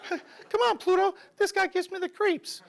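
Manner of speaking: very high falsetto cartoon mouse; high-pitched mouse mascot aside; Mickey Mouse. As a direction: squeak it to your cartoon dog. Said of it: "Mickey Mouse here".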